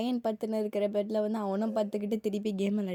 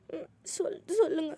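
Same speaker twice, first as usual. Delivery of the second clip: tearful, broken words; crying daughter answering dad; choked crying; 6 semitones higher than usual. Acted. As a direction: speak through tears. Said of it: "அழுதுட்டு இருக்குது".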